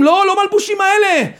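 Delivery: high-pitched insistent protest; imitating an urgent no; acted refusal. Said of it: "והם משובים".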